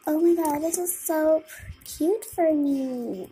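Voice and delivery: usual speaking voice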